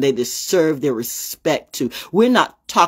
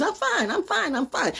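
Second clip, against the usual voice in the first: mom saying she's fine; 12 semitones higher, 6 dB quieter than usual. Retold or imitated. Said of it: "I'm asking her how she is".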